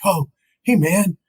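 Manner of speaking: high-pitched mock pleading; pleading friend. Imitating Vukovich to his dead friend, high pleading. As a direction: coax a friend who won't answer, voice way up.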